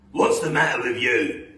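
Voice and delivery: loud, irritated husband; Mister Twit. Acted. Mister Twit to his screaming wife, loud and irritated. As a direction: bellow the question.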